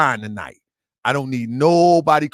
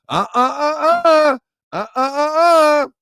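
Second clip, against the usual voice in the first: mock sobbing; 9 semitones higher, 3 dB louder than usual. Mocking someone crying.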